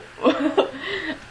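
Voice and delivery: a short, embarrassed laugh. Real, not acted. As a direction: laugh at yourself. Laughing at friends' answer.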